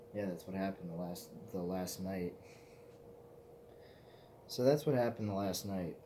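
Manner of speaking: usual speaking voice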